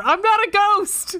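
high, loud and slow, a hammed-up protest; mock shout. Retelling the ghost encounter, a mock slow yell. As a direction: yell it slowly as if wrongly taken for a ghost.

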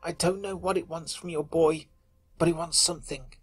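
soft, slow, pleading whimper. Acted. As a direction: beg them, voice breaking. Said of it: "his voice whimpered".

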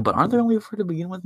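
quick, high-pitched imitation of a quibbling listener; mock objection. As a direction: pipe up fast as the objector.

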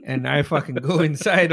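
usual speaking voice

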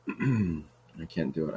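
husky, strained throat; throat needs clearing. Real, not acted. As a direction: talk through a clogged throat. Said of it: "I have to clear my throat".

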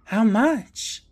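high-pitched, sucked-in question; eager asking. Acted. Mom, sucked into the charade, asks Skipper, eagerly. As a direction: play the hooked, credulous mom. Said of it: "Mom is sucked into this whole charade and asks".

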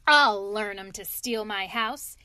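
loud, angry cry; cried out. A narrator's reading. Toad swinging his stick at imaginary foes, loud cry. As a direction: shout it, brandishing a stick. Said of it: "he cried".

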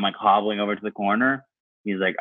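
ordinary delivery

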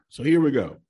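ordinary talk, nothing marked

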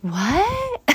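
high-pitched, hammed-up disbelief; comically aghast, about to laugh; mock shock. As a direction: squeak it out as if scandalized. Mock shocked reaction, breaking into laughter.

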